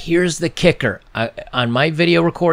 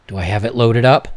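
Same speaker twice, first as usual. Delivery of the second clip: ordinary delivery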